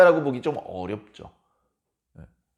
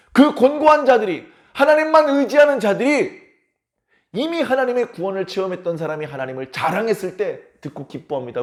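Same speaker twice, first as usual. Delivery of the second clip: ordinary talk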